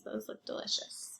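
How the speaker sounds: hushed whisper to herself; whispered aside. Real, not acted. Teacher whispering about the cookies on the page. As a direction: breathe it as a private aside.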